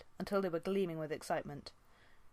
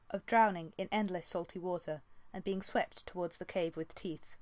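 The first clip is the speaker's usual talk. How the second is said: usual speaking voice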